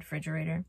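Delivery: "refrigerator" cut down to "fridgerator"; repeating the slip. Shown as a demonstration. Vlogger explaining her slip, "refrigerator" said as "fridgerator". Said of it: "I was gonna say fridge".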